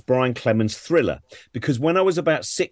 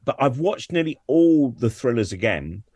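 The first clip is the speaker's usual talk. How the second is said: normal talking voice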